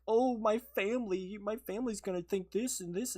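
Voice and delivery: retelling partner's upset over family; mock heartbroken girlfriend. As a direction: imitate her dismay, a bit overdone. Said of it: "she was immediately heartbroken".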